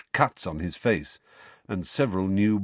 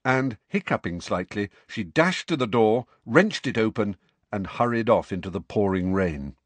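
ordinary delivery

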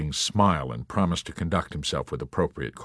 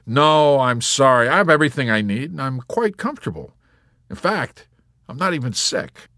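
high, cheerful reassurance, loud at first; reply through a grin, pitched up; grinning; 7 semitones higher, 5 dB louder than usual. A narrator's reading. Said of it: "still grinning".

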